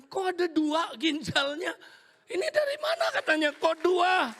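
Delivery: very high, loud, laughing disbelief; astonished doctor. Retold or imitated. Doctor finding two kidneys, shrieking in high disbelief. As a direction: squeal it in total disbelief. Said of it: "Dia kaget".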